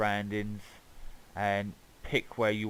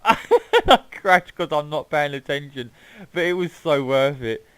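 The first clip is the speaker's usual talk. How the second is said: laughing; 4 semitones higher, 9 dB louder, slower than usual. Real, not acted. Laughing after crash.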